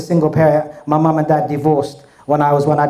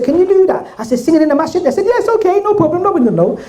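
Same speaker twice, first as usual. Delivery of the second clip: much higher, excited imitated voice; eager brothers; 13 semitones higher, faster than usual. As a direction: play the keen brothers urging him on.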